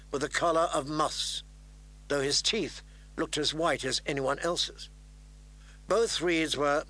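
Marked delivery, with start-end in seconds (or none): none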